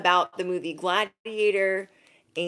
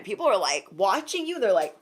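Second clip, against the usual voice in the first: ordinary talk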